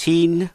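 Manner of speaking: put-on teen with a long ee; long vowel. Shown as a demonstration. Teacher contrasting teen with the ending of forty, long vowel. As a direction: hold the ee in teen. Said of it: "có y dài".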